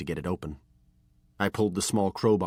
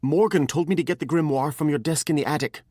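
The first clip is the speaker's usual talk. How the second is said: put-on higher, louder boy rushing it out; blurted confession; 9 semitones higher, 7 dB louder than usual. A narrator's reading. Ashamed apprentice blurts out the truth, higher. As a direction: spill it all at once. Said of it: "I blurted out".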